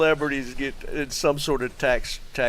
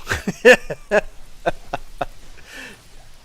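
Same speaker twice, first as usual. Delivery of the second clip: real laugh; 10 semitones higher, 4 dB louder than usual. Real, not acted. Reacting to funny chat message.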